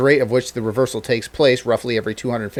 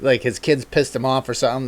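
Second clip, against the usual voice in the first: ordinary talk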